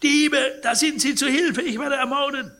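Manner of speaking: high, loud cry of alarm; piercing scream. A narrator's reading. Sick man wakes from a nightmare shouting at thieves, piercing scream. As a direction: scream for help, pitched very high. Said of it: "durchdringenden Schrei".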